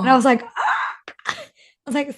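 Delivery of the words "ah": very high little "ah"; tiny high-pitched squeak of mock surprise; mock thrilled squeak. Retold reaction to being recognized.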